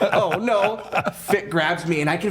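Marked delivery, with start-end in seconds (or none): 0.0-0.8 mock panic. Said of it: "now I'm thinking he's in the ring".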